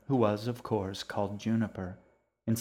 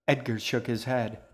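ordinary delivery

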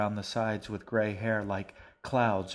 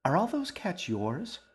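put-on high, eager little girl asking; girl asking the stranger about his cats; girl's voice; 9 semitones higher than usual. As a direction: pitch it up like a curious young girl. Young girl asks eagerly.

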